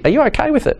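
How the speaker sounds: caring check-in. Retold or imitated. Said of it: "The question will be".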